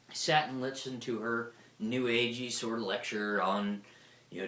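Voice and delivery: usual speaking voice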